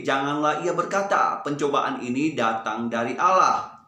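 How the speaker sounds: brisker verse recitation; steady recitation moving along briskly. Shown as a demonstration. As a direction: pick up the pace slightly, fewer pauses. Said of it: "Ayo, kita percepat sedikit".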